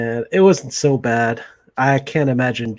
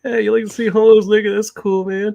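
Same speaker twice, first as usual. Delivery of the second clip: higher goofy mishearing voice; mishearing dude; 8 semitones higher, 3 dB louder than usual. Retold or imitated. Dim friend mishearing the line in a goofy higher voice. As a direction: play the dim guy who misheard. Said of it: "He's like".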